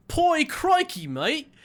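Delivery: British attempt that lands Australian; high, drawn-out Australian-sounding British accent. Shown as a demonstration. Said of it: "Can you do a British accent for me".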